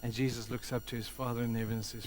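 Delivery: ordinary talk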